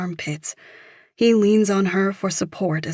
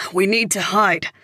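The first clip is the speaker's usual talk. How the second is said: raised urgent call after her; shouting; 3 semitones higher, 5 dB louder than usual. Acted. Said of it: "Michael shouts after her".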